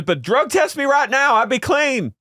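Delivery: very high, insistent imitation of her; hammed-up high-pitched dare; mock defiance. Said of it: "She goes".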